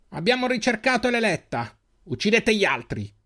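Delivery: high commanding villain voice; cold command. Acted. Extris leader ordering the others' death, high commanding voice.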